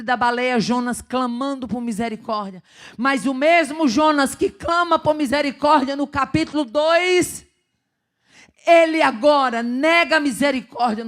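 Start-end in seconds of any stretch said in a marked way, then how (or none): 3.0-11.1 louder, higher fiery pulpit preaching; preaching at full force. Acted. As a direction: drive the point home to the congregation.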